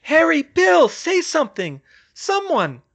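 high, loud, desperate shout; frantic high-pitched cry; panicked calling. A narrator's reading. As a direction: beg for any answer. Calling into silence for any answer.

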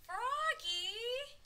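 high, loud, drawn-out call; yelling. A narrator's reading. Max calls out to his friend Froggy, yelling. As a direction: holler it out to a friend. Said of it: "yelled his friend Max".

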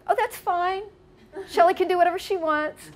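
mother calling up unbothered; lightly voiced mother. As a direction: toss it off breezily from downstairs. Noelle calling up from downstairs.

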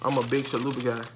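ordinary talk, nothing marked

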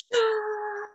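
high, drawn-out storybook scream; alarmed shout. A narrator's reading. Turtle warning friends of giant teeth, alarmed shout. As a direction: sound the alarm on one long high note. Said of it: "Turtle shouted".

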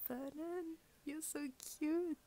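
high excited coo at the card. Real, not acted. Fan cooing at a [name] card, high and excited. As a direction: gush over him like a fan.

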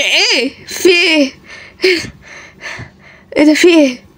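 normal talking voice

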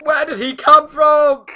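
high, loud, panicked question; alarmed shout. Real, not acted. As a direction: shout it in real shock. Reacts to the jump scare.

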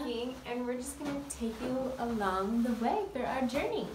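high, loud hyped intro voice; hammed-up vlog host intro; excited vlog intro. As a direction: sell it like a big vlogger.

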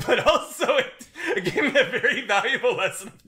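high, loud laugh through the words. Real, not acted. Laughing while confessing the dog book changed her. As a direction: laugh it out while admitting a lesson.

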